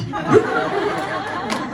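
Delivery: a high-pitched burst of laughter. Real, not acted. Laughs at luggage mix-up.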